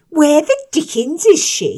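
put-on high loud bratty boy voice; whining schoolboy. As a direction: call it out like a spoilt boy. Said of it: "he continued".

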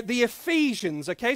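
ordinary talk

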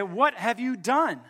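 slow, high-pitched dramatic demand; mock-grave police questioning; stern accusation. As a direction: confront a culprit, drawing out each word. Police confront the boy in story.

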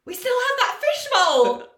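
high, loud squeal of an idea; excited shout. Retold or imitated. Friend reminding everyone of the fishbowl, squealing. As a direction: shriek it out, thrilled. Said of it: "and goes".

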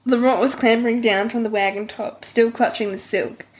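normal talking voice

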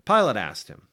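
ordinary delivery

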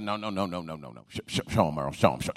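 a hint of the insistent wife, low and hushed. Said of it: "She's like".

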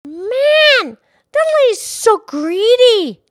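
loud, very high-pitched outburst; big incredulous reaction to the story; exaggerated exclamation. Real, not acted. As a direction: blurt it out high and drawn on the key words. Reader's exclamation after the story.

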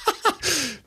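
short high laugh. Real, not acted. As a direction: burst out laughing. Laughs at himself.

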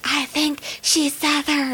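slow, voiced mock whisper. Customers guessing about the cashier, poorly whispered imitation. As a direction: imitate a slow, careful aside. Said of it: "they're whispering about as well as".

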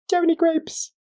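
very high little duck voice. Acted. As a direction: pipe it up high like a tiny duck. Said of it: "Duck looks up and says".

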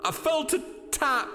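high, agitated victim of a fright; terrified Hurst. Acted. Hurst telling the others of his fright, agitated. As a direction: recount it in a high, shaken pitch.

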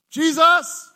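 high, loud cry of the name; desperate shout. Retold or imitated. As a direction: call out in mock desperation. Crying out when life gets bumpy.

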